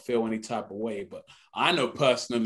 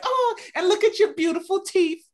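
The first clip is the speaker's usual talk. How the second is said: put-on very high, fawning girls' voice; mock swooning girls; 5 dB louder than usual. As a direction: gush like an admirer. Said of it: "they were like".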